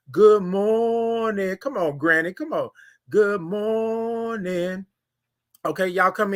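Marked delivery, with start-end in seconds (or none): 0.1-1.6 very slow, drawn-out "Good morning" with the r left off, "mornin'"; signature good morning. Shown as a demonstration. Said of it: "That's how you do it". 3.1-4.9 very slow, drawn-out "Good morning" with the r left off, "mornin'"; signature good morning. Shown as a demonstration. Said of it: "That's how you do it".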